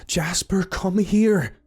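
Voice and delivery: high, loud call to a runaway dog; calling his dog. Acted. Simon calling his scared dog back from the trees, high urgent call. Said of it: "Simon called".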